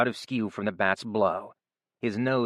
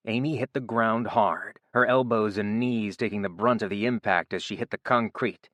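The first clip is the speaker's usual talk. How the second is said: plain reading voice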